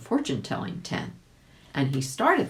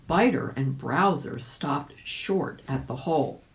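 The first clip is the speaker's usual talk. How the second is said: ordinary delivery, nothing marked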